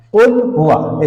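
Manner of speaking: কুল হুয়া with a full, long হুয়া; correct reading. Shown as a demonstration. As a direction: stretch হুয়া out clearly, not হু. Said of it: "এই হুয়া হবে".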